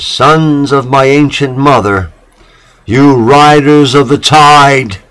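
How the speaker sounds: high, loud cry to the sailors. A narrator's reading. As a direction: call out across the water with longing. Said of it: "his soul cried out to them".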